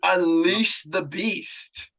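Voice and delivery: very slow, drawn-out rasp; slow, hammed-up rant introduction; mock announcer. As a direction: stretch it out like releasing a monster. Hypes up co-host's rant.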